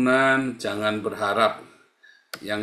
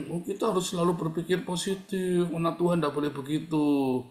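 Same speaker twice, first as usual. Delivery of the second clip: higher, softer sing-song lecturing; mock pious friend; 5 semitones higher, 4 dB quieter than usual. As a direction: lightly parody someone who always says be positive. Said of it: "Orang-orang yang". (menirukan orang sok rohani dengan nada tinggi)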